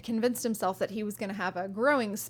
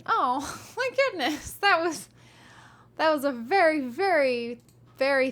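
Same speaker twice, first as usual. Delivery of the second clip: higher, louder gushing with a laugh; touched and delighted; 5 semitones higher, 4 dB louder than usual. Real, not acted. Streamer moved by a donor's message, delighted and laughing. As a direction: gush over the gift, laughing. Reacting to kind donation message.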